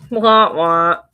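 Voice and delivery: loud mock wah-wah whine; mock crying baby. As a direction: wail it like a crybaby. Mocking whiny crying sound.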